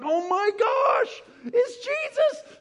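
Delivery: very high, slow crying shout; overjoyed cry. Acted. Peter in the boat recognizing Jesus, a very high joyful cry. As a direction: cry out in stunned joy.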